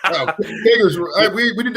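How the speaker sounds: laughing through the words; loud, amused laugh-talk. Real, not acted. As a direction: break into a delighted laugh as you speak. Amused at keg memories.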